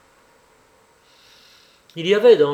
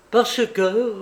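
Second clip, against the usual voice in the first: higher, suddenly energetic retort; abruptly energized, raised voice; sudden energy; 7 semitones higher, 4 dB louder than usual. A narrator's reading. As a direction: seize the words with sudden force. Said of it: "avec une subite énergie".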